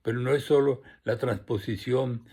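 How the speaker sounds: measured, phrased reading with deliberate pauses; formal literary address; formal essay reading. Acted. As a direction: read a prepared literary note aloud to an audience, each phrase starting firm then falling away. Reads prepared note on writing.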